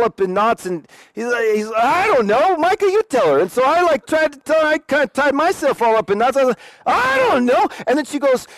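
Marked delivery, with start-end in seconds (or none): 1.8-3.4 mock-panicked, pitched high; imitating a stumped friend passing the buck; flustered friend. 6.8-7.7 high, rising, at a loss; mock-helpless stumped answer; helpless shrug. His own stumped reply.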